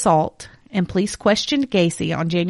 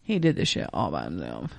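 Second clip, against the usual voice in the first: low emphatic aside; 10 dB quieter than usual. Real, not acted. Emphatic aside, much lower.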